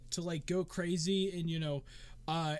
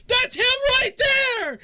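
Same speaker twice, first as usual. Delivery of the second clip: very high, loud, drawn-out yell; excited scream; 16 dB louder, slower than usual. Acted. Fan spotting the winner for the crowd, screaming. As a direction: scream it across a packed crowd.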